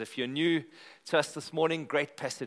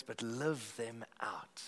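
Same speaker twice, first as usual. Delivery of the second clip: whispered; 4 semitones lower, 11 dB quieter, slower than usual. Acted. Prayer dropping to a hush.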